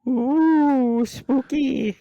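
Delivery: high, loud ghostly ooh; spooky falsetto. Pretend. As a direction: squeal it like a cartoon ghost. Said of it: "fun little tracks I can play to be like".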